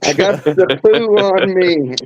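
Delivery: put-on a friend hamming up the girl's complaint; mock whiny kid. Friend mimics the daughter.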